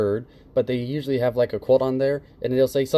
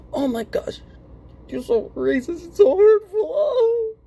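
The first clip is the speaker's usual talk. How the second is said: much higher, hammed-up fake crying, ending loud; mock offended victim; 4 dB louder than usual. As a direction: whine it like an overly wounded snowflake. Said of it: "I should, should go".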